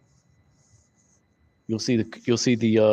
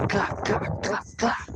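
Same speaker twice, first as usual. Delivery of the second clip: imitated clapping; 6 semitones higher, 6 dB quieter, slower than usual.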